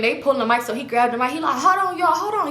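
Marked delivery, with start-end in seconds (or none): 1.6-2.5 calling over music. Retold or imitated. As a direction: shout over the music to stop it. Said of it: "He talking over the music".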